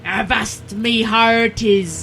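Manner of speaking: swaggering pirate welcome to the show; pirate show intro. Acted. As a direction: call it out like a ship's captain. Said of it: "we've got to do pirate talk".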